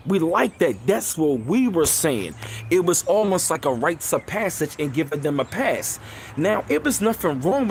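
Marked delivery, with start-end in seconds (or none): none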